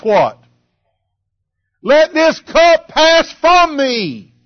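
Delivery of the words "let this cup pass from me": high, slow, drawn-out plea; crying out in prayer. Retold or imitated. Preacher voicing Jesus pleading with the Father, crying out. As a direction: stretch out the imitated cry to heaven. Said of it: "He's crying unto the Father".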